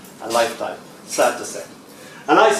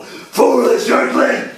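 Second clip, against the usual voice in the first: slow, loud devil's roar, pitched up; smoky sulfuric devil; 10 semitones higher, 5 dB louder, much slower than usual. Acted. The devil answering an Irish greeting, smoky sulfuric roar. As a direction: boom it out like a demon. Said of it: "a smoky, sulfuric voice will go".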